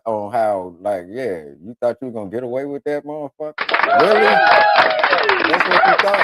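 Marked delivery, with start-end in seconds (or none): 4.0-6.2 high squealing "really?"; hammed-up incredulous squeal; mock disbelief. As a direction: shriek it in mock disbelief at the offender. Mocking someone who thought they'd escape.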